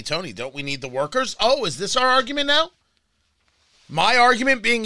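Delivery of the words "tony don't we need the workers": low, much softer mock objection; objecting listener. Host voices a listener challenging him on workers, low mock objection. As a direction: drop low and quiet to voice the objector. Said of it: "Now, you say to me".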